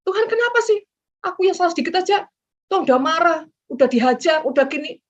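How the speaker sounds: a high, loud, aggrieved whine at God; whiny complaint. Retold or imitated. The preacher mimics a believer complaining to God, in a high, loud, whining voice. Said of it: "Jangan bilang gini".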